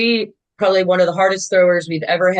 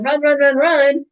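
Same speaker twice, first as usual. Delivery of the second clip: retold coach's yell; 7 semitones higher than usual. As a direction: imitate a coach urging a runner on. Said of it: "the coach is yelling".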